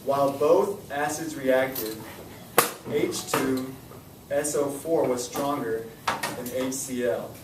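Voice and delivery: slow, deliberate reading for the class; slow read-aloud. Shown as a demonstration. Teacher reading question four aloud to students, slow and deliberate. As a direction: read it out word by word so they follow along. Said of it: "I'm gonna read it out loud".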